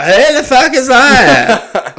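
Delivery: loud, high, slow caricature of gawkers; hammed-up outraged old-timer, drawn out high; mock indignant onlookers. Voicing staring old people, mocking.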